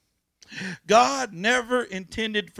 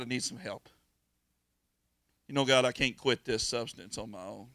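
humble prayer; 6 semitones lower than usual. Pretend. Voiced humble prayer to God.

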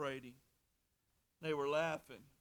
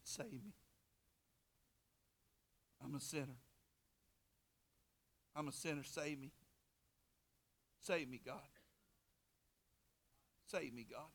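quiet retold plea; 7 dB quieter than usual. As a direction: murmur it like a sinner at the altar. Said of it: "I ran forward and said".

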